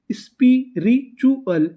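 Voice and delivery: Spiritual pulled out slowly, sound by sound; slow blending. Shown as a demonstration. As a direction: draw out spiritual for the class. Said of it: "तो पूरी meaning".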